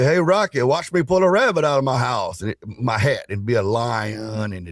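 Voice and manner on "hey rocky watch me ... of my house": a higher, goofy cartoon moose voice, lightly done; Bullwinkle. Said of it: "Bullwinkle would say".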